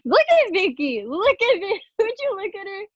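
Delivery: giggly delighted cries over Vicky's comeback; excited gushing. Real, not acted. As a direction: rave about her like a delighted fan.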